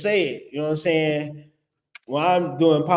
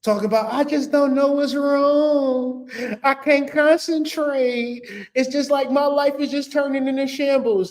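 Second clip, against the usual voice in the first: mock whiny lament; 8 semitones higher than usual. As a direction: whine it like a drama queen. Mocking the lovesick friend.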